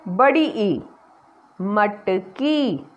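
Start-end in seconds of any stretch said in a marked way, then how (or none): 1.6-2.9 very slow, stretched word; slow blending. Shown as a demonstration. Teacher blends मटकी slowly for children, stretched. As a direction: draw each sound out for the class.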